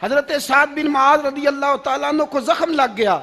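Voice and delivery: high, loud, fervent sermon cry; full-force preaching. Acted. Preacher telling the crowd of those who loved martyrdom, fervent shouting. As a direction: drive it out to the whole crowd at the top of the voice.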